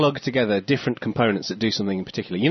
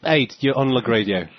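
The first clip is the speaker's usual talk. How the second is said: ordinary talk, nothing marked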